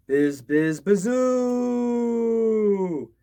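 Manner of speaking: high, loud wind howl rising on bazoo; eerie wind whoosh. A narrator's reading. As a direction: moan it like wind in the cracks. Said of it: "the wind went".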